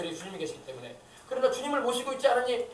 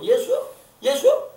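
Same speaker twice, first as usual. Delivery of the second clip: high, loud insolent query; careless, disrespectful mocking; 10 semitones higher, 8 dB louder, slower than usual. People speaking carelessly of Jesus, pitched high with mock disrespect. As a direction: toss the name out scornfully. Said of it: "우리 예수님을 향해서 함부로".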